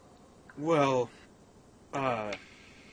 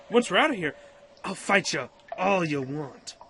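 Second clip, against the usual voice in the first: irritated retort; 5 semitones higher, 6 dB louder than usual. Acted. Arguing back to the rival.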